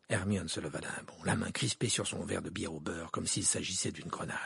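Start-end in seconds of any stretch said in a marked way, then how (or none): none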